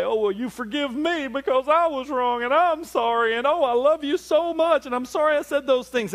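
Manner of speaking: high, loud mock sobbing apology; squeaky over-the-top make-up voice; gushing apology. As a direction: ham up a tearful gushy sorry. Mock tearful apology quote.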